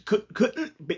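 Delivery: high, halting backpedal; acting out a nervous stammer; flustered stutter. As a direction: stumble over the words, freaking out. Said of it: "He's starting to stutter, just freaking out".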